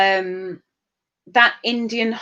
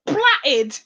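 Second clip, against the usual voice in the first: laughing; 5 semitones higher, much slower than usual. Real, not acted. Laughing at her emoji.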